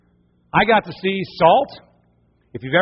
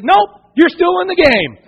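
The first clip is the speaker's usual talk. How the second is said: teasing high mock bravado; 10 semitones higher, 6 dB louder, slower than usual. Father teasing son.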